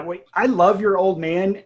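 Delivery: ordinary delivery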